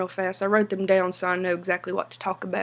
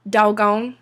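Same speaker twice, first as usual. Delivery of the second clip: ordinary talk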